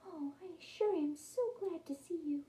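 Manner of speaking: high, soft little mouse voice; happy mother mouse. A narrator's reading. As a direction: greet your babies softly and high. Mother mouse greets her pups.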